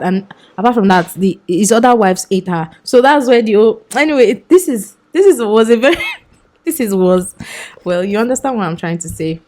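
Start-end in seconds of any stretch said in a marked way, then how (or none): none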